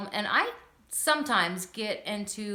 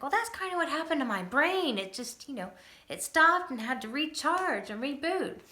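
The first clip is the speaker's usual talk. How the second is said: gentle explanation; 5 semitones higher than usual. Retold or imitated. Explaining seizure to six-year-old.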